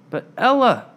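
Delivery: high, loud call of "But Ella"; shouting after her. Acted. Fiona calling after Ella as she leaves, loud and high. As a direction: call her back urgently. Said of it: "Fiona shouted after her".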